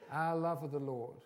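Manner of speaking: ordinary talk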